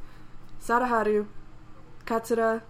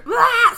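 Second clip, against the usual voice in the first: loud acted scream; 11 semitones higher, 11 dB louder than usual. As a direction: scream as if about to crash. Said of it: "Yeah, there's scr".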